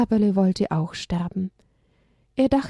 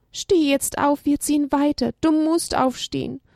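pleading; 6 semitones higher than usual. Acted. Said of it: "flehte Elia".

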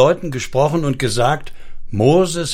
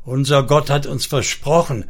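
ordinary delivery

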